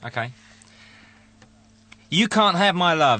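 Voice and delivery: ordinary delivery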